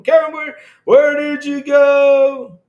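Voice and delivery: high, loud and drawn out, calling for a lost viewer. Real, not acted. Streamer calling out to a missing viewer, loud and high. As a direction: call out as if searching a crowd.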